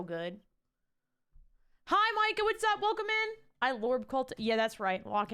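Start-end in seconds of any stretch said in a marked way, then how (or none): 1.9-3.4 high, held-up hello to a newcomer; high-pitched greeting. Real, not acted. Streamer welcoming a viewer into the stream, high greeting.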